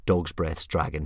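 plain reading voice

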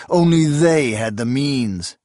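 ordinary delivery, nothing marked

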